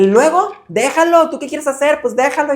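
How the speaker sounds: a grandmother losing patience with her husband; exasperated grandma. Retold or imitated. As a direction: tell him to let it go. Grandma giving in.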